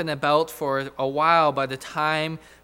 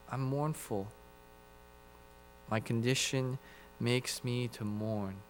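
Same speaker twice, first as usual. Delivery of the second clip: softer, sorrowful inner voice; quiet mournful thought; 4 semitones lower, 11 dB quieter than usual. Acted. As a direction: murmur it to himself in sorrow.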